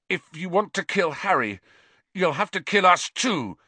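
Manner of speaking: high, fierce defiance. Acted. Injured boy defying the killer to protect his friend, fierce. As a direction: stand up to him, fiercely. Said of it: "he said fiercely".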